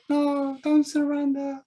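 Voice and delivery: high, slow pleading cry; desperate plea. Acted. Prisoner begging the commander not to give up, slow and high. GM repeats plea, slower, higher.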